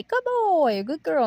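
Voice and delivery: put-on high happy good boy; praising the dog. Shown as a demonstration. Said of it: "you say".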